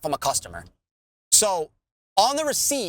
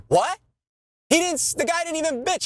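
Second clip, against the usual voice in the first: outraged disbelief; 9 semitones higher than usual. Real, not acted. Outraged at the bitching fee.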